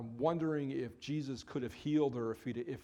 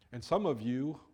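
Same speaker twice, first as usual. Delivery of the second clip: usual speaking voice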